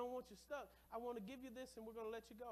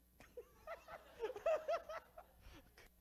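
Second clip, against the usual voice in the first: loud laughter; 9 dB louder than usual. Real, not acted. Congregation reacts to wife's line.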